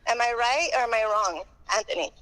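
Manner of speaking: normal talking voice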